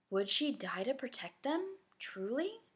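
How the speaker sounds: soft unvoiced whisper; girl whispering her doubt alone in bed. A narrator's reading. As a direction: breathe the question into the dark. Private fearful thought in dark.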